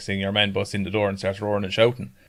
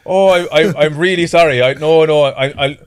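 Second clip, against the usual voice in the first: stammering, louder mock apology; guilty embarrassed; 6 semitones higher, 8 dB louder than usual. Father guiltily apologising to the lad. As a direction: fumble the sorry, hammed up. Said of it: "getting really guilty and embarrassed".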